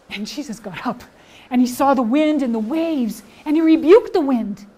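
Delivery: put-on expressive Bible story for children, big shifts in pitch and volume; animated children's storyteller; lively storytelling. Shown as a demonstration. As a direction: tell it with full drama and energy. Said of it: "this time I'm going to use all of the skills".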